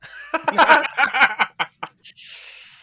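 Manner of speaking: high, loud real laugh; loud burst of laughter. Real, not acted. Mock lawyer cracking up at the flat "No", loud laugh.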